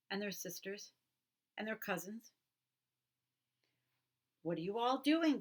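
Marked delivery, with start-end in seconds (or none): 4.4-5.4 loud, higher curious cry; excited cry. Acted. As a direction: call out eagerly to the others. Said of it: "they cried".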